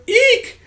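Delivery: very high, loud mock shriek; frightened squeal. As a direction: squeal like a scared child. Frightened kids squeal at threat.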